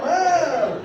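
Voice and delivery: loud, high, hammed-up Italian accent; Italian accent impression. Comic dodging gender questions with an Italian accent. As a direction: belt it out like a cartoon Italian. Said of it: "Just talk like a fucking Italian".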